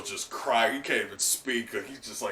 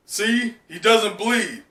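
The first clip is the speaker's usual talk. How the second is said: higher, louder triumphant point; Ashura proving it; 8 semitones higher, 7 dB louder, slower than usual. Acted. Ashura showing the fake does not bleed, raised proof. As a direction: show them, raised and sure.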